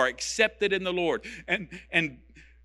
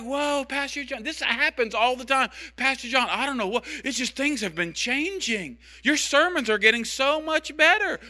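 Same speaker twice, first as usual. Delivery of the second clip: high, put-on voice of a wowed listener; amazed churchgoer; 9 semitones higher than usual. Congregant telling the pastor things are changing, amazed. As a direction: imitate a marveling congregant, pitched well up.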